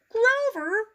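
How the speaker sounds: high, calling teacher's voice; Miss Ty. A narrator's reading. Miss Ty calling Grover, high. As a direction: call his name sweetly.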